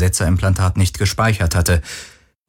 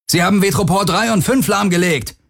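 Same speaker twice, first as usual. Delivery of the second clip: höher gerufen, gegen den Lärm ankämpfen; laut rufen, damit man sie über den Krach hört; 10 semitones higher than usual. A narrator's reading. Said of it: "rief Akina über den Lärm". (Akina ruft über den Lärm auf der Brücke)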